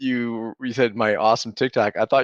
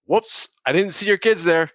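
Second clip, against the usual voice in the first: high-pitched imitated oops-sorry; retold embarrassed apology, pitched up; sheepish apology; 7 semitones higher than usual. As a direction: act out a fan caught out, voice lifted high. Acting out embarrassed fan apologising.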